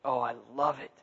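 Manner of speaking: low, soft, fading into a whisper; tender. Retold or imitated. God embracing the one who found Him, tenderly. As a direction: murmur it lovingly, trailing to breath. Voicing God's loving embrace.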